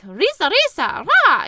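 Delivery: put-on high, accented, squeaky goblin chatter; funny goblin voice. Goblins from the film chattering, high-pitched and accented. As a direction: yap it out like a little goblin, rising. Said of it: "high-pitched, accented, funny goblin voice".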